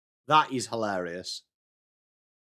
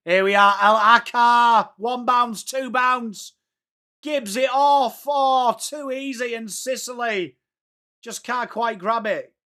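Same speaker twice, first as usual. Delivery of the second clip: higher, drawn-out excited match commentary; live play-by-play; 9 semitones higher, 4 dB louder, slower than usual. Acted. Streamer calling a bouncing run at goal, excited commentary. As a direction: call the play as it unfolds, pitched up.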